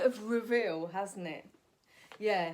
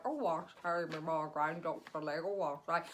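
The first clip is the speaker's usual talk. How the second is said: a lightly voiced old lady, lower; June Brown impression; 3 semitones lower than usual. Comic impersonates June Brown for fun. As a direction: croon it like a dotty old lady. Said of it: "loved doing impressions of her".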